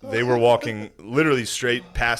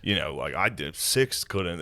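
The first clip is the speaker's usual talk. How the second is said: muttered blame; 6 semitones lower, 8 dB quieter than usual. Retold or imitated. Imitating Wood mumbling blame.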